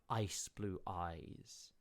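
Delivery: normal talking voice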